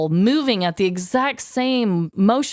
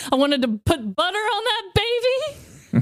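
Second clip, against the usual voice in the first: put-on gleeful baby eater; 9 semitones higher than usual. Playing the baby-eating parent.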